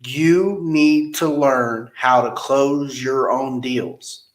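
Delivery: "You need to learn how to close your own deals" said slowly, each word weighed; slow emphasis. Shown as a demonstration. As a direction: quote the key advice slowly and deliberately. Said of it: "those words right there".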